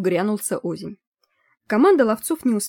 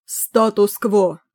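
slow stony booming judge; talking statue; 4 dB louder, much slower than usual. A narrator's reading. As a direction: boom it out like a stone judge. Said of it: "раздался каменный голос судьи".

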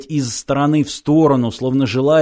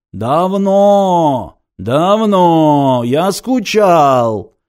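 slow, loud howling creature; wolf voice; 3 semitones higher, 4 dB louder, much slower than usual. A narrator's reading. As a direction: howl the words out. Creature Ункас speaks.

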